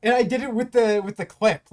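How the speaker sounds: excited. Real, not acted. Elated after record run.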